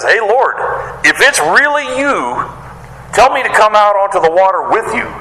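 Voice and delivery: Peter calling out high, stretched words; bold request. Acted. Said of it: "that's pretty bold".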